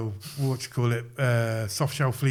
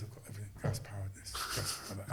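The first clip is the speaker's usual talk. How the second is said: much softer, low-key bragging; hushed boast; 3 semitones lower, 13 dB quieter than usual. Retold or imitated. Tom Hardy quietly brags about his airsoft kit, hushed. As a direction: drop right down, like sharing a secret.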